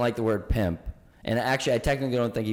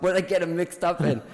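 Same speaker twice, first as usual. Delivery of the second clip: laughing worry; 7 semitones higher than usual. Retold or imitated. Johnny voiced in retold story.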